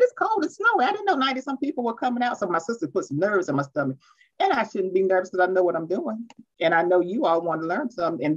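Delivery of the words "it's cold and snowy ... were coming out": high-pitched retold disbelief, quickening; incredulous protest. Speaker retelling her reaction to a big turnout, incredulous.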